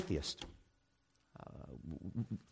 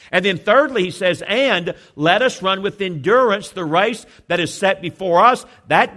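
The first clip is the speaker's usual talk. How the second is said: preaching at full force; 2 semitones higher, 6 dB louder than usual. Acted. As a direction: drive the text home to the congregation.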